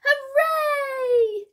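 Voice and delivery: high, loud, drawn-out cheer. A narrator's reading. Fish freed from the net, cheering. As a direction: cheer like a crowd of little cartoon fish. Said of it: "cheered the fish".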